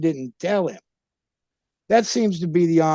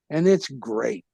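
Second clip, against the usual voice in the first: ordinary delivery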